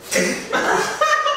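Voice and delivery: a sudden high laughing fit; high burst of laughter. Real, not acted. Friends cracking up at a joke.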